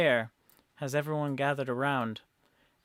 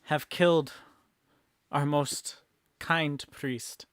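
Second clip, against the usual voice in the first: slow, raised-voice proclamation to a crowd; rallying mayor; 2 semitones higher, 8 dB louder, slower than usual. Acted. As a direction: stir up the masses, word by weighty word. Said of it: "inspiring the masses, being like".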